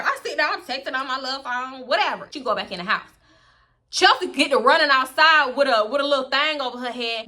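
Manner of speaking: ordinary delivery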